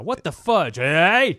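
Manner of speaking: almost swear, then pull it back; mock near-swear. Son nearly cursing, so the host blurts it loud. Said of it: "my boy's skirting the edge now".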